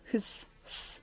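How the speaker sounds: imitated snake hiss with long s. Shown as a demonstration. Teacher demonstrates the snake's sound to learners, hissing. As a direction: hiss like a snake, drawing out the sibilant. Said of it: "ഇങ്ങനെയാണ് സ്നേക്കിൻ്റെ ശബ്ദം".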